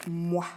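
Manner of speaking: "moi" with the m held, gliding into wa; blended sounds. Shown as a demonstration. Teacher blending a word for a young reader, "moi" drawn out. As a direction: stretch "moi" smoothly from a humming m. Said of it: "Je peux faire la vague".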